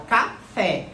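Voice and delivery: café in two parts, ca-FÉ; stress on last syllable. Shown as a demonstration. Teacher confirming the tonic syllable, café stressed on FÉ. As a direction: land firmly on the fé of café. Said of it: "a sílaba tônica é a última".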